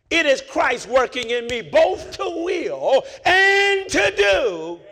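loud, high, slow preaching cry; preaching climax. Acted. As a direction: hold strengthens me out for the crowd.

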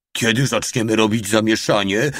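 mildly eager, impatient question. A narrator's reading. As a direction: ask with a touch of restlessness. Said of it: "spytał niecierpliwie mały". (z lekkim zniecierpliwieniem)

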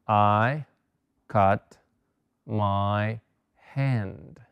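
I cut my finger spaced out with pauses between words; word-by-word dictation. Shown as a demonstration. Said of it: "dictation word by word".

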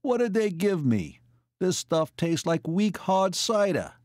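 high-pitched, put-out complaint; complaining. A narrator's reading. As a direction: gripe like you've been cheated. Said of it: "he complained".

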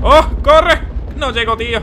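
high, loud frantic screams; panicked shouting. Real, not acted. Gamer screaming while the monster chases him, panicked. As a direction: scream to run for your life.

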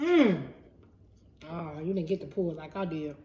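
chewing between words; talking while chewing. Real, not acted. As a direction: mouth partly full. Seen 3: Woman bit pizza at -2.0, lips moving closed while talking.

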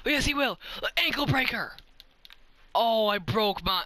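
high-pitched crowing over the move; thrilled play-by-play shout; triumphant exclamation. Real, not acted. Celebrating an ankle breaker.

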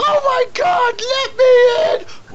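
screaming, crying to be let in; panicked scream. Retold or imitated. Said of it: "someone's like".

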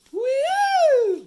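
very high, loud held "woo"; sustained high-pitched creature call; Lapras creature cry. Acted. Host does a Lapras impression, long high wailing cry. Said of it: "That was my best Lapras impression".

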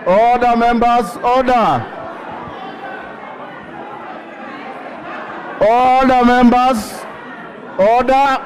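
calling for order. Real, not acted. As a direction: keep hammering for order above the din. Speaker calling rowdy House to order.

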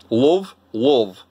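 "love" with a northern "luv" vowel; Yorkshire accent. Shown as a demonstration. Vlogger attempting Yorkshire, rounded Yorkshire accent. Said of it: "I can't do a good Yorkshire".

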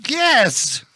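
desperate yes. Acted. Answering have you had enough.

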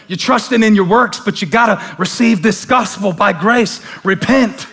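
rising preacher's call to repent; preaching build. Acted. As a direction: build the sermon up with fire.

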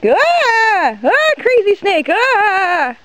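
playful fake scream at a snake; mock fright. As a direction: pretend to be scared, hammed up.

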